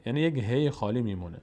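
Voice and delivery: ordinary talk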